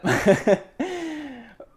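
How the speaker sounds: high, drawn-out laugh; real laugh. Real, not acted. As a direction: burst out laughing at your own twist. Laughs at own plot twist.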